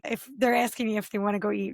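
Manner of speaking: usual speaking voice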